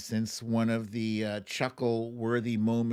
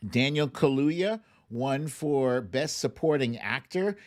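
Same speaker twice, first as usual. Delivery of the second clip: ordinary talk, nothing marked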